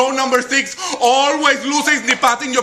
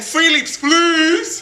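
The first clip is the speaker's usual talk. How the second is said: higher, mock teacher plea; imitating the teacher; 5 semitones higher, 3 dB louder, much slower than usual. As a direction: send up the teacher's pleading tone. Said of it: "in the middle of my second".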